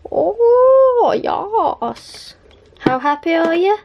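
high loud gleeful oh; delighted. Real, not acted. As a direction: revel in it. Delighted celebration.

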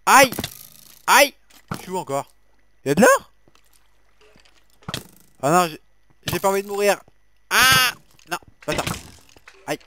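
high, loud panicky yelps; panicked cries. Real, not acted. Panicking under attack in game. (cris paniqués, aigus et forts)

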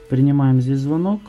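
ordinary delivery

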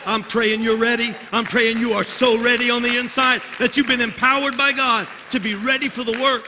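put-on rally the young missionaries like a revival preacher; full-force preaching. Preacher rousing the church for the Alaska mission, fervent and loud.

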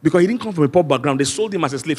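usual speaking voice

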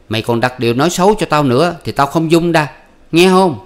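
high, loud bickering voices; husband and wife arguing at night, high and loud; heated quarrel. Acted. Voiced marital quarrel continues.

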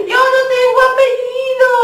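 high, loud sing-song wail; mock crying. Number six crying to the others, high loud wail. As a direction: complain in tears like a small child. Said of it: "el seis comenzó a llorar".

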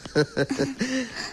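soft chuckle; a short amused laugh. Real, not acted. Laughs at the bathroom mix-up.

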